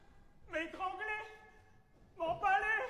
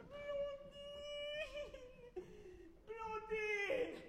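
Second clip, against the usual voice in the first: calling out; 6 semitones higher, 7 dB quieter, much slower than usual. Acted. Calling up to a window.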